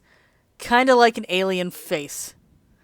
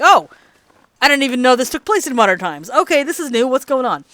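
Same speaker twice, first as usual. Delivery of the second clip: higher and louder, caught off guard; surprised realization; 6 semitones higher, 7 dB louder than usual. Retold or imitated. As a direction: react like the game just threw a curveball. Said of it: "and I'm like".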